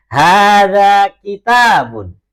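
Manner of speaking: slow, loud, drawn-out Arabic, every syllable of "Hada masjidun" stretched; slow model reading. Shown as a demonstration. Teacher modelling Arabic for the class, slow loud recitation. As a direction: chant it slowly for the class to repeat. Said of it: "yang lain ikutin".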